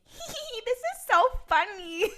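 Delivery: high, giddy cackling giggle; giggling Chuck. Retold or imitated. Chuck laughing at Dean's suffering, giddy high giggle. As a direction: giggle like a god amused by his toy. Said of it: "Chuck being like".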